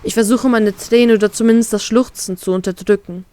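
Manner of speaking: ordinary delivery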